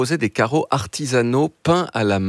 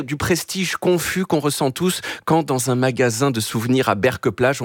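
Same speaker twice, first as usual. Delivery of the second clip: ordinary delivery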